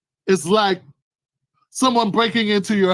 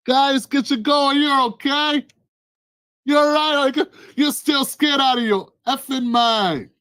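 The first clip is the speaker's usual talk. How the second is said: mock friendly invader; 5 semitones higher than usual. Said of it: "It's like".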